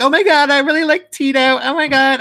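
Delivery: put-on high, fast Valley girl gush. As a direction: squeal it like a gushing Valley girl. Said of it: "sounding like a Valley girl".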